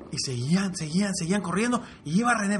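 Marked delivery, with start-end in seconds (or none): none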